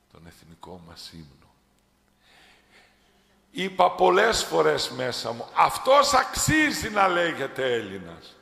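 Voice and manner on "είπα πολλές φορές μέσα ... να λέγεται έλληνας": high, loud preaching cry; passionate shout. Real, not acted. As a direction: proclaim it at full voice. (δυνατή φωνή κηρύγματος)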